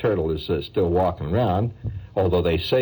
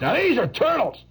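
high-pitched triumphant show-off; proud reveal. Acted. Painter unveiling his real turtles, high proud reveal. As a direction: present them proudly in a high voice.

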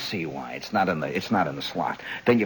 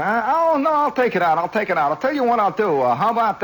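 high, loud, wheedling bargaining voice; eager high-pitched pleading; 10 semitones higher, 4 dB louder than usual. Retold or imitated. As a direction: hook the junkman with a high eager whine. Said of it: "And so I say".

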